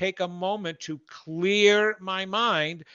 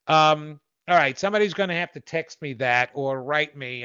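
normal talking voice

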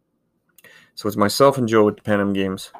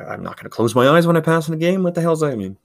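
higher and faster, scoffing at the drill; incredulous; 7 semitones higher, faster than usual. Retold or imitated. Young goalie mocking a strange drill, incredulous. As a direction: poke fun at how silly it seemed. Retelling his disbelief at drills.

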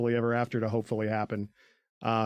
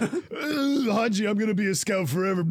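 mock tearful; 7 semitones higher than usual. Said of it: "I burped while saying that".

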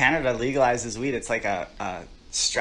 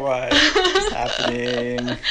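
laughing disbelief; much slower than usual. Retold or imitated. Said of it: "I'm like".

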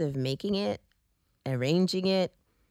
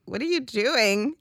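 higher, drawn-out incredulous question; retold bafflement; 7 semitones higher, slower than usual. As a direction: imitate baffled onlookers, stretching it out. Retold onlookers puzzled at her.

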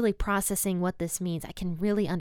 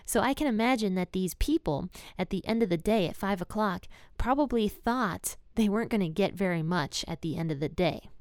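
ordinary delivery

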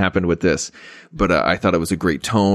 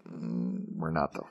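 let-down murmur, much softer and lower; deflated; 5 semitones lower, 16 dB quieter, slower than usual. Retold or imitated. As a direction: sink into a disappointed mumble. Deflated answer to the sequel setup.

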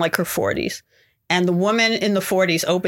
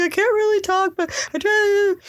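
teary whimper; 13 semitones higher than usual. Retold or imitated.

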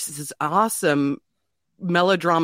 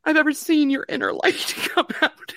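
high, tearful voice; choked with grief, apologizing at the end; crying; 13 semitones higher than usual. Real, not acted. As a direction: push the memory out through real sobs. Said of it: "oh, I'm gonna start crying".

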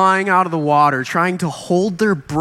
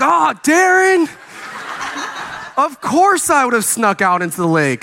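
exaggerated protest; 7 semitones higher than usual. Youth pastor answering Darren's remark about his ministry, exaggerated protest. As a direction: cry out as if comically found out.

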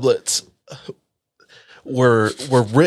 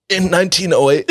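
coughing, voice strained and high; coughing through it; 8 semitones higher than usual. Real, not acted. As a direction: push the words out between coughs. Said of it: "it instantly burned my nose".

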